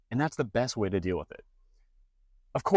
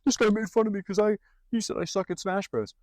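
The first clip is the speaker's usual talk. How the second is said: mock whiny crybaby; 10 semitones higher than usual. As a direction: whine like a hurt kid posting online. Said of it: "cry about it and post online".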